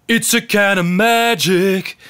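macho chest voice. Retold or imitated. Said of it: "macho, manly, deep in the chest".

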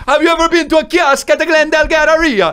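Jamaican comic doing standup; slow Jamaican stand-up bit; Jamaican accent. Retold or imitated. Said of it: "you're so stupid".

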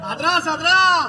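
loud, slow exorcist command; commanding shout. Acted. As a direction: drive the devil back, loudly. Exorcising the demon.

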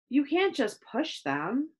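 ordinary delivery

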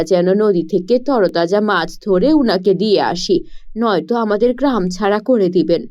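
a tired fisher parent explaining gently. Acted.

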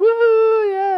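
high, loud cheer; cheering crowd. Retold or imitated. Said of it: "Everyone's like".

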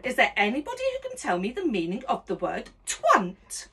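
usual speaking voice

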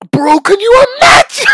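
high, loud, cracking with hype; a real excited squeal breaking into a laugh. Real, not acted. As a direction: gush like a fan who can't contain it. Hyped about a dream unit.

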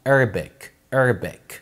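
"Arabic" as ER-bek, low and falling; slightly snooty. Shown as a demonstration. Teacher repeating the model, "Arabic" as snooty ER-bek. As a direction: give "Arabic" a haughty ER-bek. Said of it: "في تناكة كده في الموضوع".

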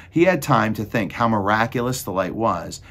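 usual speaking voice

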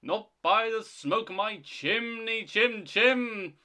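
high, loud storybook chant; defiant refusal. Acted. Toby refuses the ghost entry, defiant. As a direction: chant it out, rising on the repeats.

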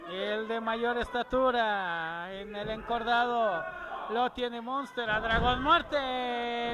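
live ringside play-by-play, building to loud; wrestling commentator. Real, not acted. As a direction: call the match as it happens.

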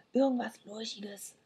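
soft hushed whisper; whispered reply. A narrator's reading. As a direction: whisper back secretly. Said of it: "flüsterte ich zurück".

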